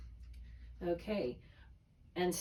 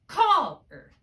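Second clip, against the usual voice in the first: "caller" as CALL-er, very loud high CALL; stress on first syllable; 7 semitones higher, 10 dB louder than usual. Shown as a demonstration. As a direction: hit CALL hard, let er fall. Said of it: "I'm gonna put the accent here".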